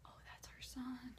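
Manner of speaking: whispered dawning realisation; stunned whisper. Retold or imitated. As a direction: barely voice it, as it sinks in. Stunned realisation, said under breath.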